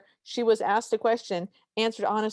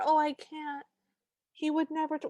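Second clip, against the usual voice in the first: higher voice, lightly imitating a timid friend; reluctant friend; 5 semitones higher than usual. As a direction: play a friend making hesitant excuses, pitched up. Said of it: "had one of those friends".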